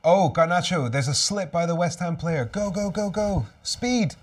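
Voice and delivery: real fan yelling go go go at the screen; climbing excitement as the chance opens; excited cheering. Real, not acted. Live chance on the counterattack.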